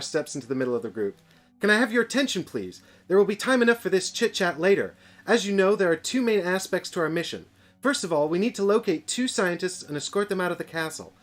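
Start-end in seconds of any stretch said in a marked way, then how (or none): 1.6-11.1 higher, louder officer giving orders; brief the troops with authority; raised commander's mission briefing; commanding briefing voice. Acted.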